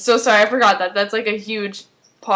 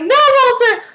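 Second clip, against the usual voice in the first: high, loud cry of protest; acted alarmed shout; 6 dB louder than usual. Nina trying to stop Walter crossing over, acted alarmed shout. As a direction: shout it as if stopping someone, hammed-up. Retold, she jumps at him.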